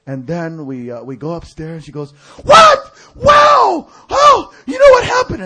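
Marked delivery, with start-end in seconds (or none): 2.4-5.4 very high, loud shrieks of astonishment; hammed-up imitation of stunned excitement; amazed screaming. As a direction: shriek it like an overexcited woman. Imitating sister-in-law's amazed screaming.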